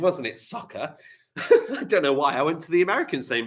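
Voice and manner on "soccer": laughing. Retold or imitated. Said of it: "That was the American saying".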